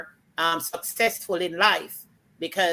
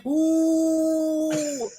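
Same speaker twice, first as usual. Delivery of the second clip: high, far-off mock ghost ooh; ghost moan answering; 7 semitones higher than usual. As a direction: moan back like a hidden ghost. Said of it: "him hear somebody say".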